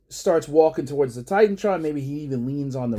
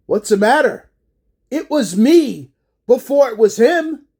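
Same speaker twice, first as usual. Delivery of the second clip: louder, higher "What's the matter?"; rising shout; 6 semitones higher, 5 dB louder than usual. Retold or imitated. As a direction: snap the question as anger breaks out. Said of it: "And he goes".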